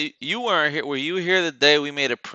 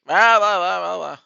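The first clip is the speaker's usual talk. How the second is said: mocking blah blah; 8 semitones higher, 8 dB louder than usual. Real, not acted. Mocking babble over blocked video.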